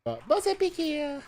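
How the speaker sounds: high put-on Jamaican accent. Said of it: "you had to do it in the, in the Jamaican, accent".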